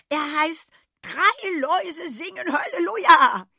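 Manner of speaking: high, loud, gushing goat voice; excited goat. A narrator's reading. Goat urges the animals into her film, gushing. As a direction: sell the film role with shrill glee.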